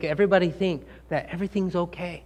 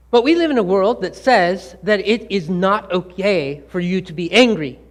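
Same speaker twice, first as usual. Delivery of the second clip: usual speaking voice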